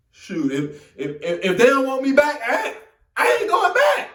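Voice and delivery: rising to a loud, high shout; telling mama he won't go back; angry outburst. Retold or imitated. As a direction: build into a furious yell.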